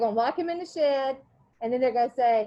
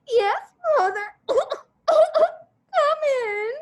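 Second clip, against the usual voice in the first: put-on much higher, louder, crying boy's whine; high, louder sobbing child's voice; whimpering boy; 13 semitones higher, 6 dB louder, slower than usual. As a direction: whine it out like a hurt kid. Todd groaning after his beating.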